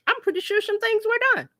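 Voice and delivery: high, quick sarcastic aside. Real, not acted. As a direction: toss it off with a knowing smirk. Sarcastic jab at the new administration.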